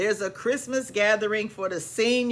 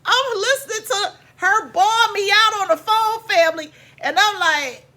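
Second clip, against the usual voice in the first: much higher, louder and worked up; loud agitated retelling; 11 semitones higher, 7 dB louder than usual. Real, not acted. Narrator telling family about being bawled out, loud and high. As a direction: tell it heated and high. Mom bawling her out.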